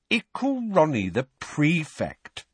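put-on slow, teasing baby talk; mocking. A narrator's reading. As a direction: tease him like a toddler. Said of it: "mocking baby talk".

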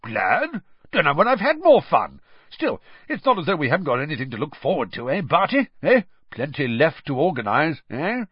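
high, bouncy protest; jovial, shocked Bagman. A narrator's reading. Bagman shocked anyone would be glad it's over, boisterously. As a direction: protest jovially, pitch leaping on each "eh". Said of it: "Ludo Bagman looked shocked".

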